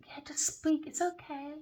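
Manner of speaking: soft high whisper. Retold or imitated. She hushes to the man, high soft whisper. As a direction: coax him in a hush. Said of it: "she was like".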